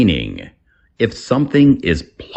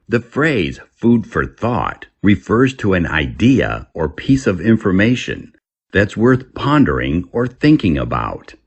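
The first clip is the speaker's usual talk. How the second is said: ordinary talk, nothing marked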